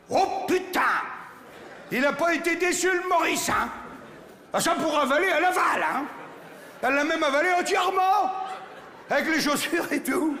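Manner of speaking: high-pitched, hammed-up shock; aghast exclamation. Survivor telling mates how Maurice got eaten, aghast. Le rescapé raconte le sort de Maurice.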